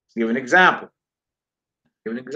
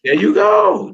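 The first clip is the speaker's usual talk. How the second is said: excited praise; 7 semitones higher, 4 dB louder than usual. Real, not acted. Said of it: "praising student's answer".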